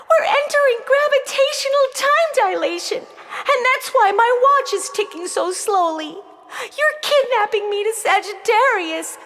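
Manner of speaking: high-pitched, urgent explanation; panicked girl voicing what's happening; frightened young girl. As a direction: spell out the danger in a rush.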